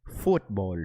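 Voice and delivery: lightly accented "Football"; accented try. Shown as a demonstration. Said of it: "Okay, let me try it".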